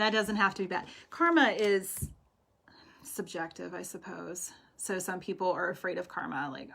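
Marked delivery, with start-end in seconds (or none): none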